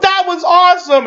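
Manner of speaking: high, excited yelp of delight; excited cheer. Retold or imitated. Deadpool cheering the fight from the background, high excited yell. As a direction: squeal it out like a thrilled fan.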